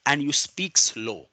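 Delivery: deliberately slow, each word drawn out; slow demo. Shown as a demonstration. Teacher demonstrates slow speaking to his law class. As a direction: show them how slow speech sounds. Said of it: "इसकी tip ये होती है".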